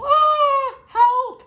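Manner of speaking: very high, piercing scream for help; terrified shriek at the top of the voice; full scream. Acted. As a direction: scream as loud as possible. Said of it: "screams as loud as possible".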